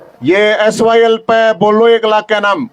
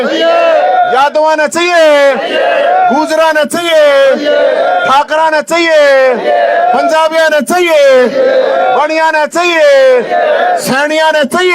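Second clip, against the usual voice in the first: rally call and response; 5 semitones higher, slower than usual. Acted. Leader rallies the crowd about water rights, fiery shouted call-and-response. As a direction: whip the crowd up, each answer a short cry.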